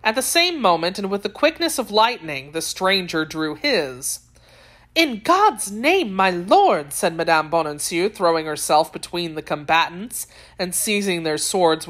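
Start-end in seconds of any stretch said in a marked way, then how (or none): 4.9-6.9 high, drawn-out plea; pleading alarm. A narrator's reading. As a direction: implore them to stop, slowly and high-pitched. Woman throws herself between swordsmen.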